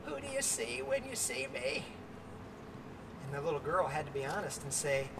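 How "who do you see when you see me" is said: put-on higher, soft croaky voice of an old woman; a lightly croaky old lady asking quietly. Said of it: "croaky voice she said".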